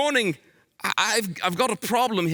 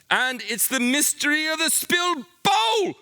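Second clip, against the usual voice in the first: mock-grand detective reveal; dramatic reveal; 8 semitones higher than usual. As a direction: unveil the mystery with a flourish.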